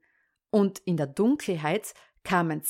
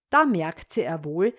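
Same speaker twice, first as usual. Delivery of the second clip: ordinary delivery, nothing marked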